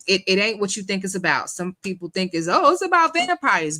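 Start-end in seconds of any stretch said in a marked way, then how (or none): 2.5-3.8 high, louder mock exclamation; mock naive opinion. Host mimicking people who misread the movie, mocking. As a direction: imitate people jumping to the obvious idea.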